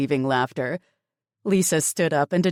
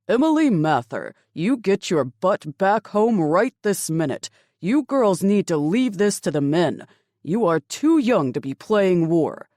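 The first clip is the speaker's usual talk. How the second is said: loud, higher scolding father; angry father; 4 semitones higher, 7 dB louder than usual. A narrator's reading. Father scolding his daughter over the radio, loud and angry. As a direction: bark orders down the radio.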